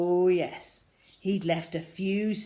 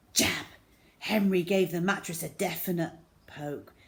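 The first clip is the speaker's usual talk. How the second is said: plain reading voice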